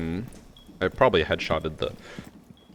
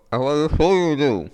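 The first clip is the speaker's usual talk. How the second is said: mocking imitation; 9 semitones higher, 7 dB louder, much slower than usual. Mocking friend's garbled words.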